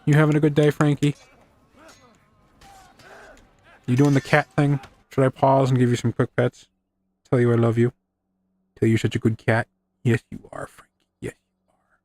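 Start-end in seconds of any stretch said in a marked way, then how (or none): 0.0-9.7 pet talk to a cat. Real, not acted. Greeting and petting his cat. 11.2-12.0 soft whispered coo to the cat; tender whisper to a purring cat. Real, not acted. Cooing to the cat.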